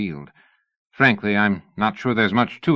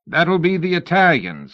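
raised, higher voice over the din; shouting; 9 semitones higher, 4 dB louder than usual. A narrator's reading. Godwin shouting over a roaring engine nearby. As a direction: call out over the engine racket. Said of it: "Godwin shouted".

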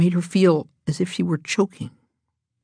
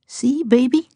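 high, doting coo to a pet; tender baby talk; 8 semitones higher than usual. A narrator's reading. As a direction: croon it to the cat. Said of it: "he said, petting her".

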